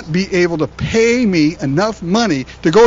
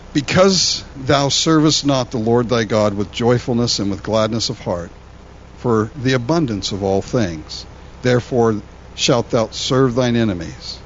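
lower, measured reading of the verse; scripture reading; 6 semitones lower than usual. Acted. Pastor reading Deuteronomy aloud to the congregation, solemn. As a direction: read the King James words solemnly.

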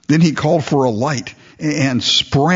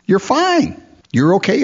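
pitched way up, sing-song mockery of easy reassurance; mocking psychology talk; 7 semitones higher than usual. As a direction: lampoon the feel-good counselor. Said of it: "they tell you you don't need to be saved".

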